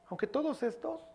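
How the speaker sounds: aunque todos estos mocked, high and rising on todos; mocking boast. Preacher mocking boastful Peter, high mock swagger. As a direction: lampoon Peter's cocky boast.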